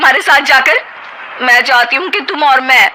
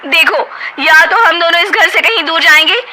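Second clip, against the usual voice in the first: bitter ultimatum; 7 semitones higher than usual. Acted. Wife ultimatum.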